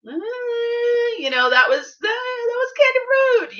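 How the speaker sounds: mock high-pitched hesitation, stretching the hedges; hesitant husband. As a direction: imitate a husband wincing out a reluctant verdict, high and loud. Voicing husband's hesitant verdict on rudeness.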